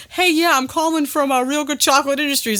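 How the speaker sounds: playful imitation of a cold caller; imitated phone salesman. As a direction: put on a chirpy caller's pitch. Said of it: "As soon as Lewis was like".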